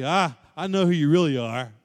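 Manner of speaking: loud, high, scornful accuser; accusing voice. Acted. People condemning the listener for past sins, in a loud, scornful accusing voice. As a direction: hurl each label like an accusation. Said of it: "people may look at you and say".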